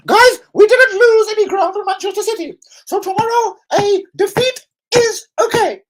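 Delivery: high, loud mock-cheerful voice; hammed-up smug supporter; mocking complacent fan. Mocking complacent club fans' line.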